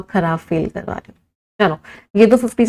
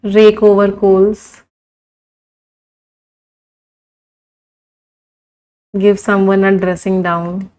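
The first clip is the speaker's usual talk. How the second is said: very slow, word by word dictation; much slower than usual. Shown as a demonstration. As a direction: spell it out slowly for the class to write. Teacher dictates idioms slowly. (धीरे धीरे लिखवाते हुए)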